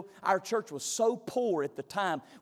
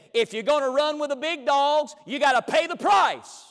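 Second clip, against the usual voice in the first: high, booming shout across the church; loud, high-pitched imitation of a blunt churchgoer; loud retold quote; 7 semitones higher, 8 dB louder than usual. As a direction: call it out loud like a heckler's verdict. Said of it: "he said it loud".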